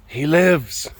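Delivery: playful theatrical cry; dramatic exclamation. Pretend. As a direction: announce it like a monster come to life. Mock-dramatic cry on seeing animal.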